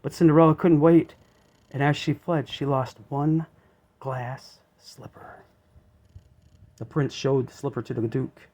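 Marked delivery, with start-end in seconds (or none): none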